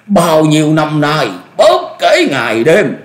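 high, loud furious tirade; enraged screaming. A narrator's reading. Betrayed old master berating his merciful junior, screaming. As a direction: rail in bitter outrage, pitch way up. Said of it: "rồi tức tối gào thét".

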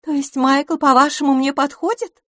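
high-pitched astonished question; surprised. A narrator's reading. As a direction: ask in amazement, voice well up. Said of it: "удивлённо спросила мисс Лафос". (удивлённо, высоким голосом)

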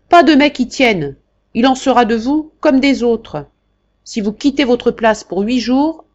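loud, high, bossy threats; the marquis threatening Jacques, then ordering him to take dictation; domineering old marquis. Acted. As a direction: cut him off and lay down the law.

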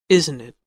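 "isn't it?" falls at the end; falling tune on "isn't it?"; falling tag question. Shown as a demonstration. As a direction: drop "isn't it?" expecting agreement. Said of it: "falling intonation or rising intonation".

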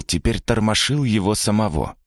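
ordinary delivery

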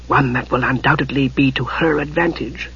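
soft aside to his brother; whispered aside. A narrator's reading. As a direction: murmur it privately to the brother. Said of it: "in a whispered aside".

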